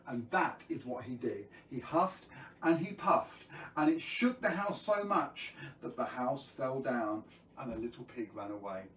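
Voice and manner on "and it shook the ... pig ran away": usual speaking voice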